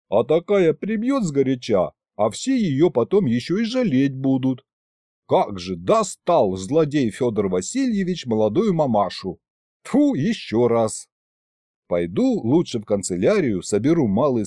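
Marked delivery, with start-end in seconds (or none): none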